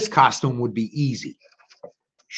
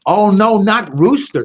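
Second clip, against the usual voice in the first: mock panicked thought; 5 semitones higher, 6 dB louder than usual. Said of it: "thought Turkey".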